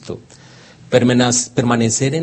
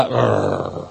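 lightly imitated dog growl, softer; 4 dB quieter than usual. The dog growls back at the boy, a soft mock growl. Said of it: "me gruñe".

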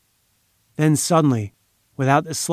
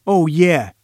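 mild growl, raised in pitch; scornful growled challenge, lightly acted; 4 semitones higher, 4 dB louder than usual. A narrator's reading. Henchman growling an objection at his boss. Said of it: "he growled".

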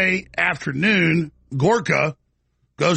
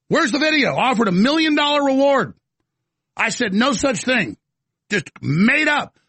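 indignant outburst; 6 semitones higher than usual. Real, not acted. Indignant rebuttal.

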